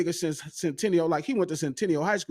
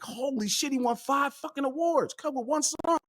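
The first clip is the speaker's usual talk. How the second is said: high and hushed, amazed; retold fired-up reaction; 9 semitones higher, 3 dB quieter than usual. Fan screaming at five awards, retold fired-up amazement. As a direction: relive the disbelief high-pitched but softly. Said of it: "I'm screaming 'cause I'm so fired up".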